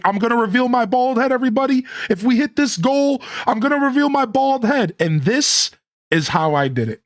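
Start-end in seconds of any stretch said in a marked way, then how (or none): 0.0-5.0 high, quick streamer hype, imitated; retelling a hyped-up promise to chat; hype announcement. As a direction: build up the reveal like a showman. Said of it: "I was like".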